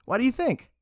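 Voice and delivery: high-pitched, indignant comeback; scoffing retort. A narrator's reading. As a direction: answer as if it's obvious, pitch lifted. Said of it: "Richard scoffed, answered".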